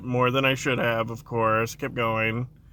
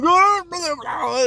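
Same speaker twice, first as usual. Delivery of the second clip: high, loud fake panic gurgle; mock drowning cry; 6 dB louder than usual. Big brother pranking his sister, high fake drowning cry. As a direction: ham up being dragged under. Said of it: "I'm like".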